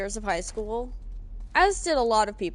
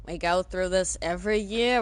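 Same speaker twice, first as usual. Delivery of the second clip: ordinary talk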